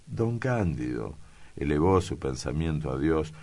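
usual speaking voice

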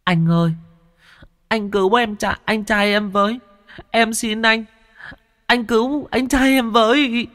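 put-on very high, tearful child begging; a little ghost child sobbing for help. A narrator's reading. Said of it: "Đứa bé bấy giờ mới òa khóc, túm tay thằng Thiên mà nức nở". (giọng trẻ con cao vút nức nở van xin)

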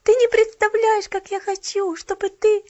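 high, loud pleading; eager plea. A narrator's reading. Girl urging shopgirl, eager. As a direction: urge her.

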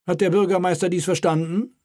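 king's voice. Acted. King prods the mayor at the table, commanding.

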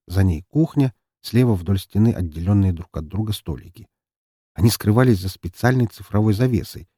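usual speaking voice

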